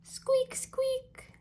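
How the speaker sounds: very high, thin little squeaks; tiny squeaks. A narrator's reading. Reading Ducky's game to children, high-pitched squeaking. Animal squeaks in picture book.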